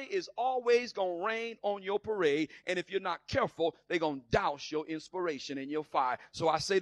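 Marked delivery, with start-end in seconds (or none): none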